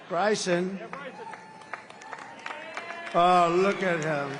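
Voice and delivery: calling across the room. Real, not acted. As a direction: call out to find him. Calling out to missing golfer.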